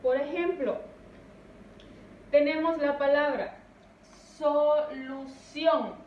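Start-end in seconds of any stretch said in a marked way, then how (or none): 4.4-5.9 solución drawn out slowly, leaning on the last syllable "ción"; stress on final syllable. Shown as a demonstration. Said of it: "la sílaba tónica es sion". (solución muy lento, alargando y acentuando "ción")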